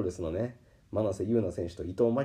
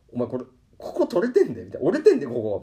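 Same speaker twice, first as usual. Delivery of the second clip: louder, higher pointing it out; insistent complaint; 3 semitones higher, 8 dB louder, faster than usual. Retold or imitated. He insists to his staff member that the bike is broken. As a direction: make her look at the damage.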